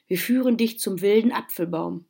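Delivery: ordinary delivery, nothing marked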